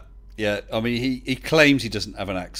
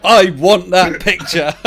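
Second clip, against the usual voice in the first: loud, high, slow and emphatic; big eager outburst; gleeful demand; 6 semitones higher, 10 dB louder, slower than usual. Real, not acted. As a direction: demand it with delight, each word drawn out. Gleeful demand after shell suit confession.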